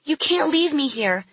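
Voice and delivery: put-on high, desperate child's plea; crying out. A narrator's reading. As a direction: plead not to be abandoned, pitch way up. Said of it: "Lily cried".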